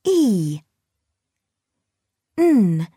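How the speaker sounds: "E" and "N" said as separate letter sounds; sounding out words. Shown as a demonstration. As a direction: sound out "E", then "N". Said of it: "Say the sounds".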